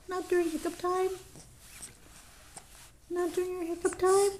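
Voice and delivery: high coaxing singsong. Real, not acted. Mother coaxing her baby to smile, singsong.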